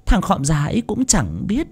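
high, soft witch-like voice; gloating wicked woman. Acted. Wicked wife gloating to her lover, high and hushed. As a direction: purr over the stolen fortune.

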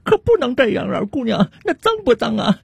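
put-on very high-pitched, fussing older woman; flustered auntie. Auntie tapping a young woman on the bus, flustered high voice. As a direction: scold and fret like a worried auntie. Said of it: "然后冲她急着白火地说道".

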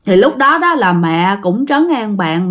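usual speaking voice

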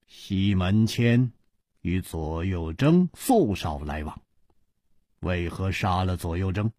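low, soft musing, slightly held back; pondering hero. Acted. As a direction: think aloud through a puzzle. Hero puzzles over the killings. (低沉轻声地沉思自问)

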